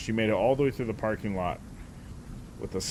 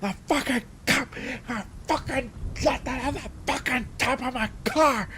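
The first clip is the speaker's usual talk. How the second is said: mock yelling. Driver yelling at someone on speakerphone, high mock yell. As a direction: yell it at the phone. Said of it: "to yelling into her phone".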